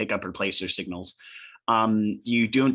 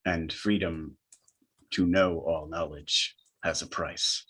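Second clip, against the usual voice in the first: low, measured entity voice; attempted booming voice; 6 semitones lower, 4 dB quieter, slower than usual. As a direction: intone it low and slowly as the transmission. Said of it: "you hear in a booming voice".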